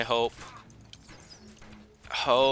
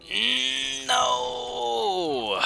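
long very high wailing no; anguished cry. Real, not acted. Gamer wailing as the combo fails, high and anguished. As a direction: wail in despair at the loss. Killer card gets destroyed.

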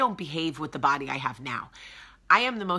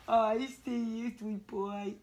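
cooing to the dog; 5 semitones higher, 5 dB quieter, slower than usual. Real, not acted. Owner cooing at her dog outside, soft and slow.

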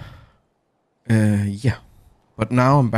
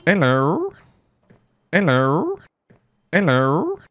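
high, processed hello voice; mixer voice effect; 9 semitones higher, slower than usual. Shown as a demonstration. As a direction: voice changer pitched way up. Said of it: "thing over here where I can do".

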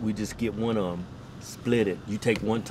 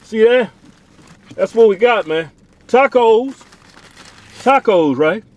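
high, loud, slow food reveal; excited showing off; 10 semitones higher, 13 dB louder, slower than usual. Real, not acted. Showing the camera their Taco Bell, loud and excited. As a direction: present the meal like a prize.

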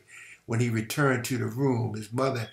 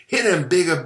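loud, higher urgent shout; 6 semitones higher, 7 dB louder than usual. A narrator's reading. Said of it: "Buddy shouted".

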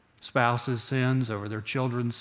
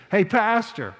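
a high, hammed-up call; calling out high to the pastor in front of everyone; mock scream; 8 semitones higher, 3 dB louder, slower than usual. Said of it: "he's screaming".

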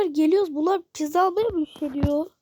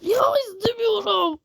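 annoyed shout; 5 semitones higher than usual. Real, not acted. Fed up with the game's trade offer, shouting.

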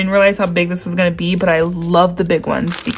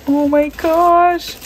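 slow and much higher, drawn out; thrilled gasp; 9 semitones higher, much slower than usual. Real, not acted. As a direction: savor it in delight. Thrilled at the kit.

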